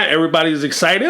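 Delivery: normal talking voice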